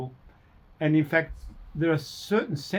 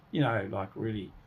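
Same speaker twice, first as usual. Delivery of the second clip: ordinary talk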